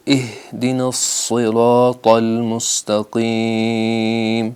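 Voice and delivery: اہدنا الصراط المستقیم recited very slowly; careful tajweed chant; slow recitation. Shown as a demonstration. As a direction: model the verse for students. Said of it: "یہ تین غلطیاں یہاں ہونے کا خدشہ ہے".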